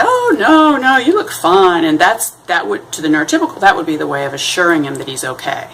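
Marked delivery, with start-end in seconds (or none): 0.0-1.8 high, sing-song "no, you look fine"; playing the polite friend, pitched up; mock reassurance. Person reassuring a friend, mock-sweet and high. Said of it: "they expect you to say".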